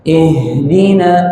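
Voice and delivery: "Ihdina" slowly, with breath flowing through the h. Shown as a demonstration. Teacher modelling the big ha, flowing breath. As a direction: let an airy h run on in "Ihdina". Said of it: "mengalir nafas".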